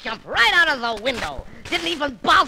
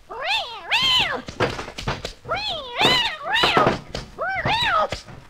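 wild high woo-hoo cackle; crazed triumphant cartoon laugh; Daffy cackle. Daffy whoops as the cat chases the mouse, crazed high cackle.

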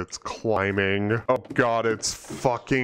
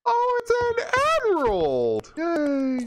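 much higher, squealing with delight at an emerald; slower than usual. Real, not acted. Gift turns out to be emerald.

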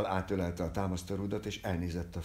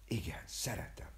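soft, near-voiceless whisper; whispered confession; 6 dB quieter than usual. Acted. Viktor answering whether he loves her, whispered. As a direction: whisper it tenderly. Hushed love confession to the girl.

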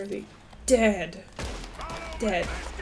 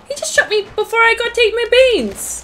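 loud, high-pitched protest; indignant outburst; 12 semitones higher, 6 dB louder than usual. Real, not acted. Player shot before eating beans, indignant outburst. Shot while about to eat.

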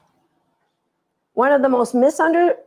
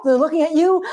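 ordinary talk

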